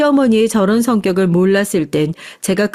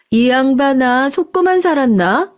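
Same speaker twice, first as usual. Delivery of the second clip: exasperated retort; 5 semitones higher than usual. Acted. Salon staff answering the doubting mother, exasperated. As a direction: throw it back impatiently.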